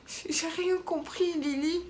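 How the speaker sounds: high, slow, baffled whine; playful high-pitched confusion; mock bewilderment. Real, not acted. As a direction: stretch it out, totally lost. Exaggerated confusion.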